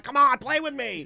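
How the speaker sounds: high, pleading puppy voice; the dog begging its owner to play, in a high squeaky voice. Retold or imitated. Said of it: "He's, like, begging".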